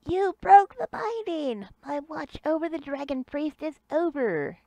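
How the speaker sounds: high, slow squeaky monster; squeaky creature voice. Acted. GM voices escaping Jot.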